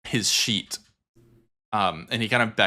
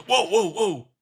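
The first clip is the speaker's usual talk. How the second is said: startled prisoner; 9 semitones higher, 3 dB louder than usual. Acted. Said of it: "The prisoner goes".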